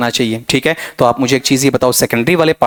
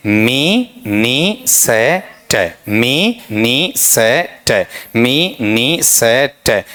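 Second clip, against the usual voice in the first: syllable drill; much slower than usual. Shown as a demonstration. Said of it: "सबका pattern एक जैसा".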